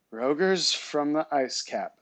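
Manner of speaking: usual speaking voice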